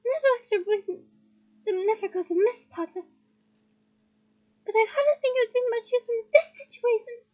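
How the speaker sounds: high, bright-pitched small wizard voice; high little professor. Acted. Flitwick explains to Harry, high and chirpy.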